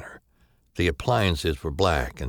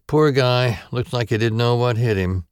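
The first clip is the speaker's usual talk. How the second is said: higher, louder weary medical examiner; a tired doctor shaking her head; female doctor voice; 7 semitones higher, 6 dB louder than usual. Acted.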